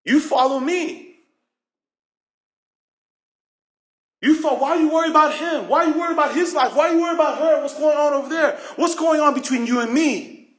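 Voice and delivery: preacher voicing Jesus's rebuke; Jesus challenging. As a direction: confront Peter with pointed questions. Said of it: "So it's basically saying".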